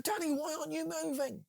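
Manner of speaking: very high mock disbelief; baffled soldier. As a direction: plead with the frozen recruit. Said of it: "they're going".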